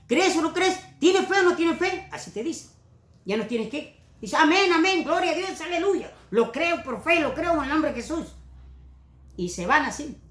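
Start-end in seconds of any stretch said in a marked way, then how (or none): 0.1-2.1 high-pitched rapid-fire preacher questioning; preacher's challenge. Retold or imitated. Imitates preacher challenging the congregation. 4.4-8.3 high-pitched mock fervent assent; eager believer. Imitates eager believer's response.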